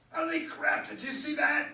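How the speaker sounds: put-on gobsmacked high teenage shout; amazed teenage boy. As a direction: blurt it out, stunned by the hit. Boys stunned by the home run.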